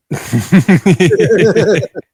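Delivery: loud burst of real laughter; short amused laugh. Real, not acted. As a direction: laugh out at the joke. Host laughs at own joke.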